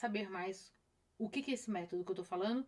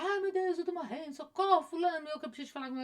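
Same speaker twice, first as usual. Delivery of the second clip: higher, rushed imitation of someone panicking; mock panic; 6 semitones higher, faster than usual. Imitating a viewer in a panic attack, frantic. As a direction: ham up the frantic cry for help. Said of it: "Em vez de você se desesperar".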